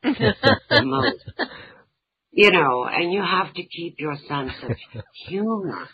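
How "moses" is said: mock Moses echo. Repeating mother's punchline.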